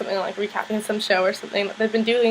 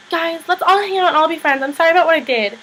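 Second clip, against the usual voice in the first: mock pleading; 7 semitones higher, 5 dB louder than usual. [name] pleading at the Dot.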